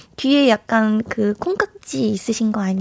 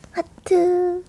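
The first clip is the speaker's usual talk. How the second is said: high, soft aegyo coo; breathy cutesy giggly; 7 semitones higher, 3 dB quieter, much slower than usual. Pretend. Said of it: "애교 오 종 세트 한번 보여주세요".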